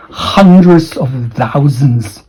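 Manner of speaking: "hundreds of thousands" spoken slowly, every syllable clear; careful "hundreds of thousands"; slow model phrase. Shown as a demonstration. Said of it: "This is how the first syllable".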